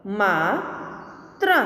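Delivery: slow, each sound drawn out, rising at the end; slow drawn-out reading. Shown as a demonstration. Teacher slowly sounding out a word for students, drawn out. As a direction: stretch the word slowly for the class. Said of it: "के उदाहरण हम देखें".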